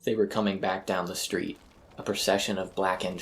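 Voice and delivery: plain reading voice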